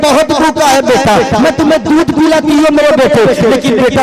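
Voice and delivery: high, loud tender mother's plea, preached; loving mother. Acted. Mother comforting her crying infant, hammed-up tender pleading. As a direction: speak as a mother soothing her hungry baby. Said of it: "अपने सीने से लगाती है और कहती है".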